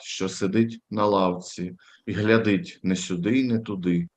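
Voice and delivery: normal talking voice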